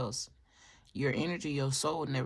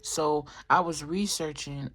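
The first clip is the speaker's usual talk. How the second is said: usual speaking voice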